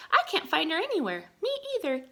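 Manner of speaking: high, fast puzzled voices; high puzzled friends searching; puzzled searchers. Acted. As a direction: sound baffled.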